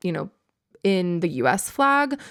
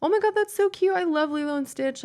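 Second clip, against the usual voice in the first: much higher, retelling a gushing squeal; high gushing retold delight; excited gushing; 9 semitones higher than usual. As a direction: relive the delight of the cute stitch. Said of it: "and I was like".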